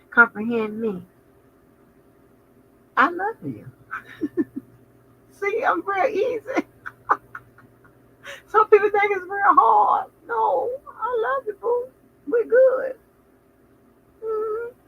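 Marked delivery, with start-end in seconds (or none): 3.0-14.7 high sugary sweet voice; sweet talking. Retold or imitated. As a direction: coo it sweetly, much higher.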